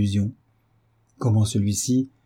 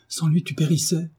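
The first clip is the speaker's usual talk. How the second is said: higher, stricken exclamation; shaken exclamation; 7 semitones higher than usual. A narrator's reading. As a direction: say it as if the danger just hit her. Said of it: "s'écria Marie". (exclamation bouleversée, plus aiguë)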